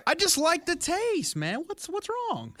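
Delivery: jumps way up in pitch; defensive high voice. Real, not acted. Defends his girly gum pick.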